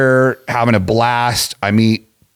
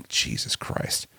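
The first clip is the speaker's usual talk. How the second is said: retold under-the-breath disbelief; very quiet mock dismay; muttered dismay; 15 dB quieter, much slower than usual. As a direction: mutter it barely voiced. Retold reaction to the website.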